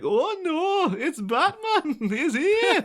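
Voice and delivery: very high, drawn-out sing-song Welsh; hammed-up Welsh alarm breaking into laughter; mock Welsh accent. Imagined Welsh gangster spots Batman, high mock Welsh accent. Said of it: "could have had a Welsh man".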